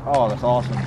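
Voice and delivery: normal talking voice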